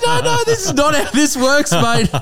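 high, laughing mock refusal. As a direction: laugh through telling him no. Comic refusing heckler, laughing.

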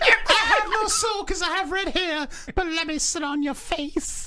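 high, laughing put-on voice; goofy character voice. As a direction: ham up a lecherous creep. Acting a ginger character.

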